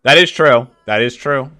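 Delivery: loud emphatic yes; loud agreement. Real, not acted. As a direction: back her up loudly. Bystander agreeing loudly.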